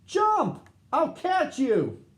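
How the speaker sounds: very high, loud, slowed call; yell. A narrator's reading. Gordon yelling to Big Bird from the car, loud call. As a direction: shout across the roaring road. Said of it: "He yelled to Big Bird".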